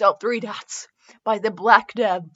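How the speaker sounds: normal talking voice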